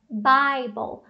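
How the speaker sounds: "Bible" slowly drawn out as BAI-bol; correct AI vowel. Shown as a demonstration. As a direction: reveal "Bible" slowly with a clear AI. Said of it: "tem um A I ali depois do B". ("Bible" devagar, BÁI-bol)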